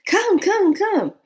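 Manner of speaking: high, loud repeated calls; imitated eager urging. Crowd calls the newcomer over, imitated excited urging.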